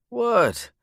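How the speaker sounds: put-on high, sweet child voice; little girl voice. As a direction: chirp it like a small girl.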